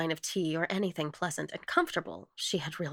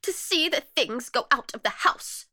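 high, loud lament; plaintive outcry, pitched well up; wailing; 8 semitones higher, 6 dB louder than usual. A narrator's reading. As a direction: keep up the distressed wail. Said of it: "wailed the other".

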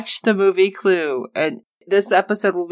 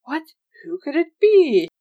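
mock suspense; 8 semitones higher than usual.

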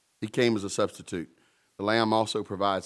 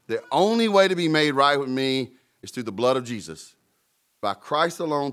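preaching declaration; 4 semitones higher, 5 dB louder than usual. Acted. Preacher declaims God's word.

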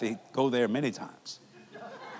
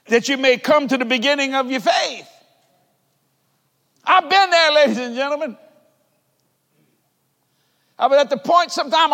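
higher, louder preacher's cry, stretched out; full-force preaching; 11 semitones higher, 8 dB louder than usual. Acted. Preacher proclaiming to his congregation, full-force shout. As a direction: preach it out to the whole room.

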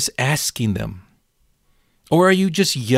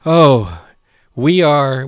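ordinary talk, nothing marked

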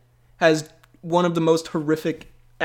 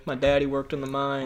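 put-on Southern Texas accent; 3 semitones lower, 5 dB quieter than usual. Podcast host imitating a movie's Southern boy, Southern Texas accent. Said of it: "Southern Texas boy".

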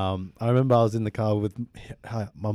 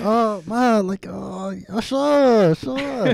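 much higher, mock-agreeable brush-off; humouring mom; 10 semitones higher, 5 dB louder, much slower than usual. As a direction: humour her with a high, fake-sweet sure.

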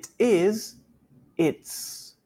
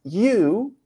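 "You" raised and punched; stress on You; 5 semitones higher than usual. Shown as a demonstration. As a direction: hit the pronoun "You" hard. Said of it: "Tengo que utilizar el pronombre". (acento fuerte en "You")

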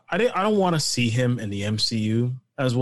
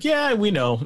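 higher, breezy yeah we know; knowing shrug; 10 semitones higher, slower than usual. Retold or imitated. Fan voicing the filmmakers' breezy shrug, higher pitch. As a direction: toss it off like the filmmakers.

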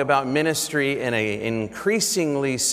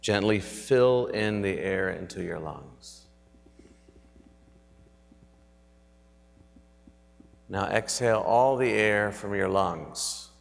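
sleep story narrator. Acted. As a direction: guide them gently into stillness. Leads breathing reflection.

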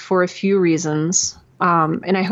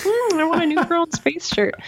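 much higher, playful musing; playful self-quote; 11 semitones higher than usual. Retold or imitated. Podcaster voicing herself wanting her own merch, much higher and playful. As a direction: muse it to yourself like a kid eyeing a treat. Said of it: "I'm like".

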